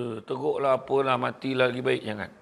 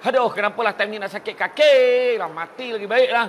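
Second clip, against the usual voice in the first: mock complaint; 6 semitones higher, 5 dB louder than usual. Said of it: "Contoh dia kata macam tu".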